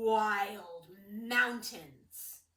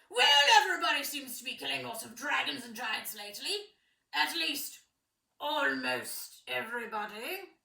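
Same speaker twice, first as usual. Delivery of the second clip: voiced king; 6 dB louder than usual. King teasing son at breakfast.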